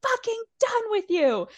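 high mock furious outburst; seeing red. Katie finally losing it at Raquel, high retold rage. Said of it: "just seeing red and is like".

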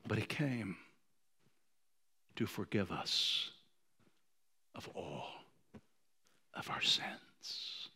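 soft, slow, tender hush. Acted. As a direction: drop to a near whisper. Hushed turn after preaching.

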